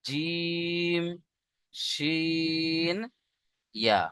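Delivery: জিম, শিন softly sustained, then short ইয়া; mid-tongue letter demo. Shown as a demonstration. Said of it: "এই যে এই জায়গা থেকে দেখেন".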